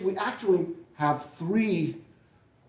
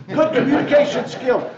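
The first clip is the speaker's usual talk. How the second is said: laughing; 7 semitones higher, 6 dB louder than usual. Real, not acted. Amused reading from the document.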